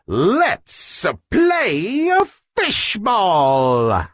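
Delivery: a slow, drawn-out happy call; cheerful yell. Acted. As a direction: call the game to start, stretched out.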